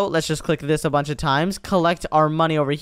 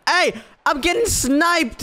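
high, drawn-out cry under fire; alarmed yell; 13 semitones higher, slower than usual. Real, not acted. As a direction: shriek that you're being hit. Suddenly hit by sniper fire.

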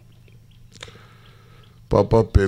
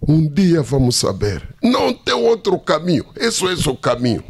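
nervous anger; 7 semitones higher than usual. Retold or imitated. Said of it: "bem nervoso".

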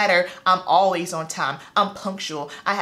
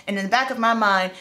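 ordinary delivery

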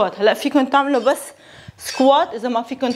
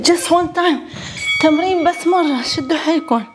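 cheering coach; 7 semitones higher, much faster than usual. Real, not acted. Urging last rep.

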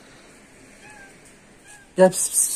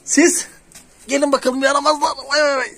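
cooing at kittens; 9 semitones higher than usual. Real, not acted. Woman calls the kittens over in high cooing baby talk. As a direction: coo up high like at babies.

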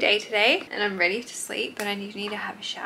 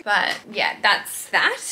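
ordinary talk, nothing marked

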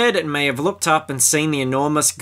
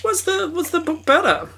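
fearful question. Real, not acted. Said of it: "fearing the answer that I might unleash".

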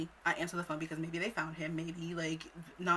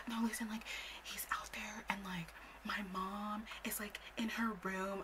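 whisper; 3 semitones higher, 7 dB quieter than usual. Retold or imitated. Friend explaining where her mom is, whispering. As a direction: whisper it haltingly. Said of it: "she's, like, whispering".

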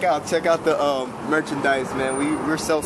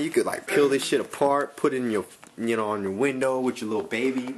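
ordinary delivery

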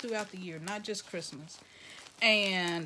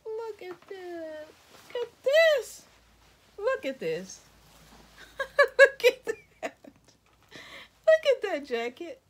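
much higher and louder, laughing; excited laughing; 11 semitones higher, 9 dB louder, slower than usual. Real, not acted. Vlogger finding a jacket in the gift box, laughing with excitement. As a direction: gush and laugh at the surprise.

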